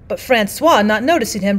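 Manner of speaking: ordinary delivery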